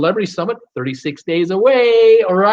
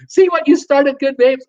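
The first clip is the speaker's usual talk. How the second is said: much higher and louder, amused; host teasing a chat regular, high and loud; playful tease; 9 semitones higher, 5 dB louder than usual. Real, not acted. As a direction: rib the viewer who set it off. Teasing a viewer after laughing.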